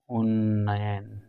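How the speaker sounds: उन्नयन stretched very slowly; slow spelled-out word. Shown as a demonstration. Teacher answering own question, drawing out उन्नयन slowly. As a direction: say उन्नयन slowly with a long double n. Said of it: "क्या हो जाएगा आपका?".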